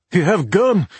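put-on cab driver in thick Russian accent; urgent, broken English, heavy Russian accent. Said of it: "in a thick Russian accent".

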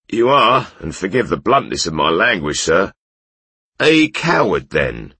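loud, high sneering insult; mocking bandit. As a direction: call him a coward.

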